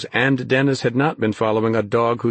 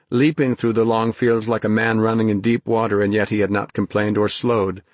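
usual speaking voice

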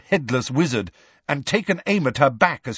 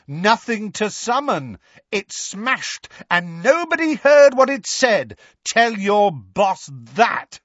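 high, defiant yell; shouting; 10 semitones higher, 4 dB louder than usual. A narrator's reading. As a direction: build to a jeering shout. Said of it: "he shouted".

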